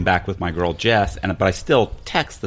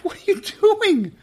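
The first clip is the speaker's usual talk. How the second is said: much higher, put-on innocent voice; mock innocent. To his glaring girlfriend, high innocent voice. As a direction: play dumb in a high sing-song.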